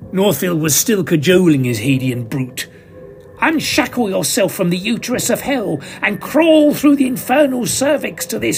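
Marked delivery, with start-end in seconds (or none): 3.4-8.6 urgent shouted incantation. Acted. Magician urging the demon up from hell, shouting. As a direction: drive the beast out of hell. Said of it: "he urged".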